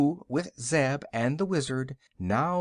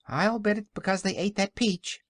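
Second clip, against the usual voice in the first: lightly voiced kitten, pitched up; kitten crying out; 8 semitones higher than usual. A narrator's reading. Kitten explains the invisible piglets, higher crying voice. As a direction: cry it out higher, like a small cat. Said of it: "cried the kitten".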